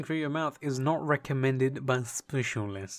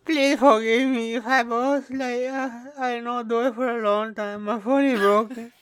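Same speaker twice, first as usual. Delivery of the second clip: put-on Pakistani accent, pitched right up; mock Pakistani accent; 10 semitones higher, 5 dB louder than usual. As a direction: apologise in a hammed-up Pakistani accent. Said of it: "This is clearly a Pakistani accent".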